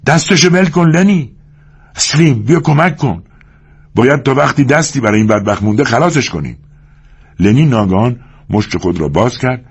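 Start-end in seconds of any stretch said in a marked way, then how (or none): 0.1-6.6 urgent raised shouting. Acted. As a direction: yell for help then calm a bit.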